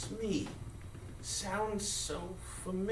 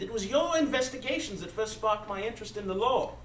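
ordinary talk

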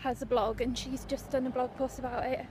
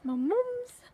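high squeaky voice; 10 semitones higher, 4 dB louder than usual. Pretend.

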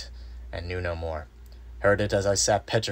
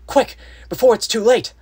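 urgent, high-pitched shout; tense anxiety; 13 dB louder than usual. A narrator's reading. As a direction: cry out in fear for a friend. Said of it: "it remained tense through anxiety for me".

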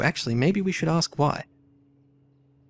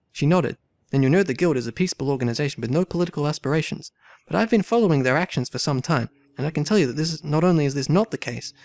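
dramatic story reading; 2 semitones higher, faster than usual. A narrator's reading. Player reading game dialogue aloud, lively character reading. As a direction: voice the valet's protest, then the lady's demand. Voicing valet and thief.

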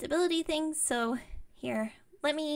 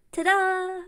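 bright-spirited ta-da, raised and louder; showy reveal; 5 semitones higher, 6 dB louder than usual. Pretend. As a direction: present it with a proud flourish. Presenting her prototype with a flourish.

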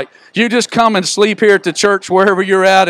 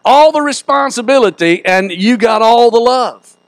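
raised, rhythmic preacher's build; preaching; 7 semitones higher than usual. Real, not acted. Pastor urging the church on, full preaching voice. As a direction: drive it home to the congregation.

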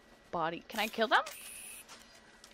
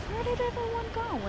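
put-on much higher, a lost kid asking; confused younger self; 13 semitones higher than usual. Left backstage at the concert, her younger self asking, lost. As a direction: play your lost middle-school self. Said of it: "I'm like".

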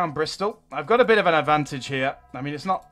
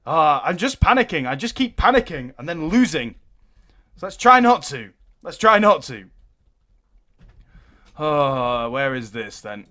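normal talking voice

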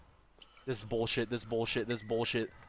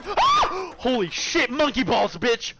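yell; 8 semitones higher, 7 dB louder, slower than usual. Real, not acted. Startled outburst.

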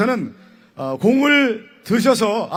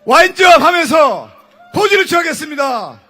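loud, high baseball caster hype; frantic mock sports commentary; 5 semitones higher, 7 dB louder than usual. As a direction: shout it like a ballpark announcer. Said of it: "그 야구 캐스터처럼 한번 하겠습니다".

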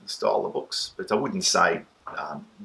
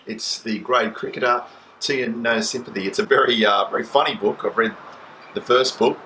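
loud, formal news-anchor read; newsreader voice; 4 dB louder than usual. Pretend. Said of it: "he's gonna use his best newsreader voice".

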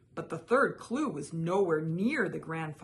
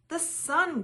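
eager guess; 11 semitones higher, 7 dB louder than usual. A narrator's reading.

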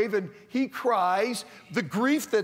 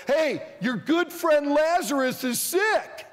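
lightly acted messenger, raised pitch; slow, higher-pitched news-bearer; messenger's announcement; 6 semitones higher, 3 dB louder than usual. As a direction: call out the news slowly, a bit higher. Messenger brings Jesus urgent news.

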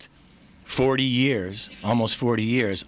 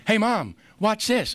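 lightly voiced eager kid, pitched up high; kid calling mom; 10 semitones higher, slower than usual. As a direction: call out to mom like a little boy, drawn out. Young boy calling to his mom.

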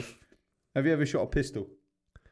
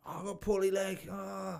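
lightly voiced ailing animal, softer and higher; soft, slightly raised put-on animal voice; talking animal; 4 semitones higher, 6 dB quieter than usual. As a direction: play a poorly animal making a small complaint. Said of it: "All these animals can talk to you".

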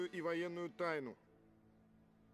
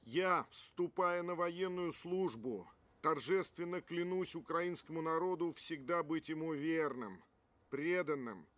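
solemn loud oath; 6 dB louder than usual. Acted. Swearing the military oath.

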